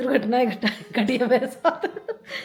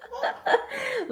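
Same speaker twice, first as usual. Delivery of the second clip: high laughing burst; 13 semitones higher, 3 dB quieter than usual. Real, not acted. Vlogger to viewers remembering her husband's anger, laughing. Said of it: "मेरी हंसी छूट रही".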